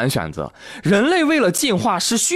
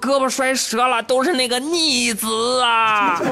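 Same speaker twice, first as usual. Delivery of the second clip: groaning injured father; 8 semitones higher than usual. Retold or imitated.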